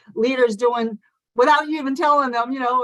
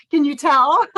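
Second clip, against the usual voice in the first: excited; 3 semitones higher, 6 dB louder than usual. Real, not acted. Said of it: "I'm so passionate".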